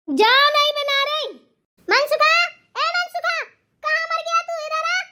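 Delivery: बहुत ऊँचे सुर में ज़ोर की पुकार; shrieking for her son at high pitch; चिल्लाकर पुकारना. Acted. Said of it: "क्यों चिल्ला रही हो". (चिल्लाती सास बेटे को बुलाए)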